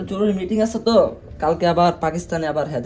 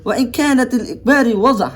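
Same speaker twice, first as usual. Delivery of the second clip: reciting Arabic text; 3 semitones higher, slower than usual. Acted. Reads Arabic text aloud.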